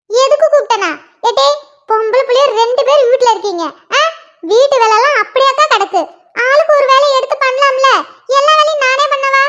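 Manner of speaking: lightly voiced nagging mother; mother scolding. Mother finds her daughters sitting idle, nagging. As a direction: grumble at the lazy girls like their mum.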